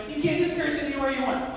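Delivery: lightly voiced protesting mom; flustered mom firing back. Outmatched mom tries to fire back, protesting. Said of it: "she's trying to fire back".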